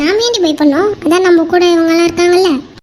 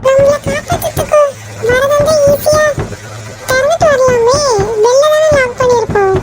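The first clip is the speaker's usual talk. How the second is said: high, worried captive voice; anxious doubt; 7 semitones higher than usual. Acted. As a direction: puzzle it out nervously, pitched high. எனக்கே பயமா தான் இருக்கு. (பதற்றத்தோடு உயர்ந்த குரலில்)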